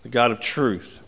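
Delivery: soft, hushed prayer voice. Acted. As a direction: pray it quietly and reverently, words trailing down. Closing prayer before sermon.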